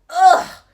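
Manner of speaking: loud high spluttering ugh of disgust; spluttered ugh. Acted. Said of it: "she spluttered".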